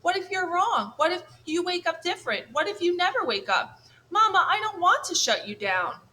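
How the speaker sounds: put-on high, anxious young bird voice; a frightened gosling begging his mama; worried little gosling. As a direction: voice of a small scared gosling. Worried gosling pleads with robot mother.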